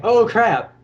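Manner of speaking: a grunt's "oh crap" as he sees it go bad; panicked mook. Mooks panicking in a fight.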